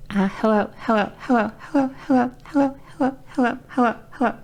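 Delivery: repeated high-pitched hellos; high voice-training warm-up; voice exercise. Shown as a demonstration. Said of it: "I was doing all the voice exercises".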